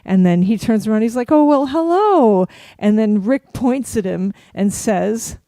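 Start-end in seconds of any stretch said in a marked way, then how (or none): 1.3-2.4 slow, high, loud drawn-out hello; imitated surprised greeting. Celebrity turning to greet a fan's family, surprised hello. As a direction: act out a delighted double-take.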